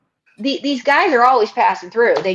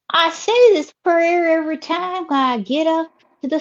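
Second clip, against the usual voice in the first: Southern lady character; 6 semitones higher than usual. Acted. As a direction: stretch the vowels like a chatty Southern belle. Host voices Ruby.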